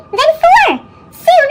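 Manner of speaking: ordinary delivery, nothing marked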